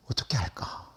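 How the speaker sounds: soft whispered question to oneself; hushed self-question. Real, not acted. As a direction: wonder it under your breath. Pondering aloud to herself.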